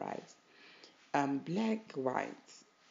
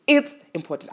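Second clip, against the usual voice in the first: It's important, said higher and louder with a punch on It's; emphatic; 9 semitones higher, 9 dB louder, faster than usual. Shown as a demonstration. Urging viewers to practice Ubuntu, It's important said loud and emphatic. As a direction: hammer home It's important as the peak of the repeats. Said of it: "I can't even emphasize it enough".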